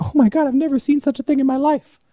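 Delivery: very high, loud squealing lady voice; imitating a shrill excited stranger; shrieking weird lady. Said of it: "She's like".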